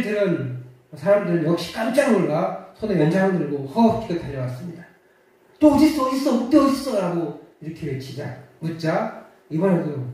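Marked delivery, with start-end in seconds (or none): 5.6-7.0 high, loud alarmed asking; alarmed villagers. Acted. Villagers rushing up to the boy, alarmed shouting. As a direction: shout around looking for the wolf.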